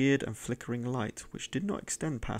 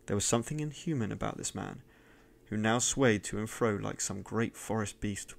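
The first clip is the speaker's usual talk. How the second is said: ordinary delivery, nothing marked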